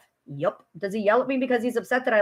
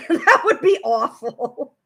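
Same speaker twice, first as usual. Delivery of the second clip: laughing; 4 dB louder, slower than usual. Real, not acted. Laughing repeat.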